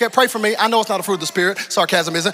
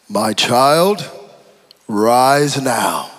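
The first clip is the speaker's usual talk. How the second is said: grand Jesus voice; 8 semitones lower, 5 dB louder, much slower than usual. Retold or imitated. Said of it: "expecting Jesus to say".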